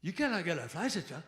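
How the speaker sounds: a lightly voiced chill California bartender; a hint of a mellow bro; laid-back bartender. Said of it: "the bartender went".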